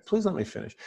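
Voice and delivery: quiet, kindly "Please let me finish"; soft and gentle. Shown as a demonstration. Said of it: "with that kind of intonation".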